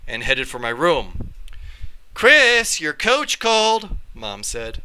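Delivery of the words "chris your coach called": raised, higher call down the hall; a mother calling her son over, a bit up in pitch. Acted. Mom calls Chris from the living room, raised and higher.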